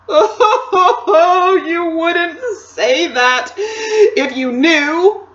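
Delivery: high, loud, slow tearful wail; sob, then wail the words out; between sobs. A narrator's reading. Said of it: "between his sobs".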